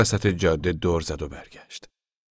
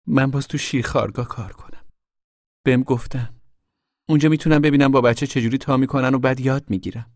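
higher, girlish put-on voice; Rose of Sharon's character voice; 6 semitones higher than usual. Daughter telling mother of nursery work, higher character voice. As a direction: lift the pitch for the daughter.

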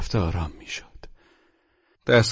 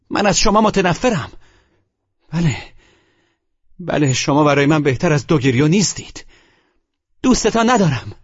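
high-pitched, hateful outcry; shouting; 6 semitones higher, 4 dB louder than usual. A narrator's reading. Polina declares her hatred to the narrator, shouting. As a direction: cry it out, pitch soaring with loathing. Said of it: "فریاد زد".

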